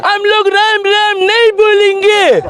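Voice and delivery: high, drawn-out mocking quote; exaggerated slow, high-pitched protest; mock refusal. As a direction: imitate stubborn refusers, stretching each word high. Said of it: "लोग ऐसे ही बोलते हैं".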